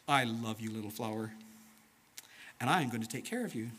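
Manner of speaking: low, soft, loving reassurance; tender Jesus. Acted. Jesus promising care to the little flower, tender and low. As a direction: speak gently down to a little one. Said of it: "he must have said something like this".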